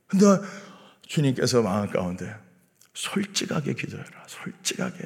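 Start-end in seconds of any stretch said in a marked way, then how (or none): 2.9-4.1 quiet inner voice. Retold or imitated. God's inner voice. 4.3-5.0 솔직하게 whispered, no voice. Retold or imitated. God's inner voice.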